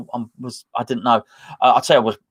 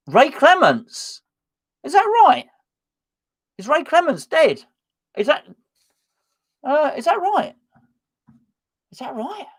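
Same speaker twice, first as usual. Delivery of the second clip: high, stunned questions, pitch way up; hearing a chat member say a famous goalkeeper died, stunned; shocked disbelief; 13 semitones higher than usual. Real, not acted. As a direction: react in real shock to sad news. Shocked at news of death.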